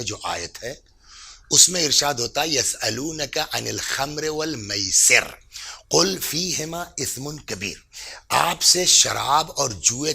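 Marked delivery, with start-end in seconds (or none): 2.5-7.8 slow Quranic recitation; unhurried scripture recitation. Acted. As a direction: recite the verse slowly and evenly.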